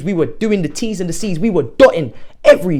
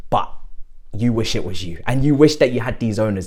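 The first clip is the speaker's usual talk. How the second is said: usual speaking voice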